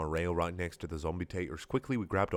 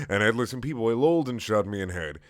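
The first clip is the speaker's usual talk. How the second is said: usual speaking voice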